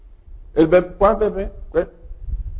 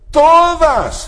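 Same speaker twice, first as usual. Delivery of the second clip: todas hit high and loud; stress on todas; 12 semitones higher, 4 dB louder than usual. Shown as a demonstration. Preacher lifting todas high so the congregation notices. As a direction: throw todas up high to grab the room. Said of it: "no sé cómo enfatizar esa palabra".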